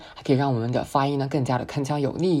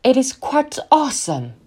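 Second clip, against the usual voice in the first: much higher, slow and thrilled; excited, pitched up; 10 semitones higher, 4 dB louder than usual. Shown as a demonstration. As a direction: lift the key high and savour "awesome". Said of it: "Once again". (提高音调，激动地慢慢说)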